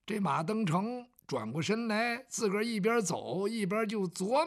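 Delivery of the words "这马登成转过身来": ordinary delivery, nothing marked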